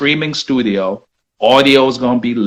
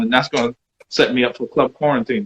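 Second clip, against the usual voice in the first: ordinary talk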